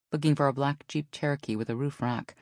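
plain reading voice

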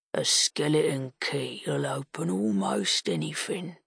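put-on lightly hoarse older man; smoke-hoarse voice. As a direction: rasp it like a lifelong smoker. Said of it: "smoke-hoarse voice".

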